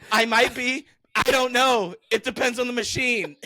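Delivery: a milder high retelling of the answer. Retold or imitated. Retelling the ATM reply to his cohost, slightly high and loud. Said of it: "I said".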